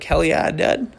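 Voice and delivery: lightly voiced surfer dude; a hint of laid-back surfer bro. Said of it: "Like a surfer bra".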